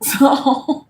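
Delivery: usual speaking voice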